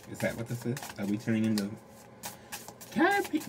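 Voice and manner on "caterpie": squeaky surprise. Acted.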